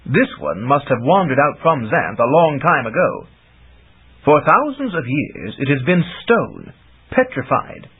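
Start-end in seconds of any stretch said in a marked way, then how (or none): none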